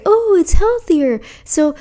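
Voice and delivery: parroting a vegan marketer's pitch; mock vegan marketer. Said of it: "they're gonna say".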